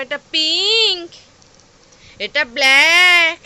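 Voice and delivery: slow, loud, word by word; slow teaching voice. Shown as a demonstration. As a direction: spell it out slowly for a toddler. Teaching colours to toddler.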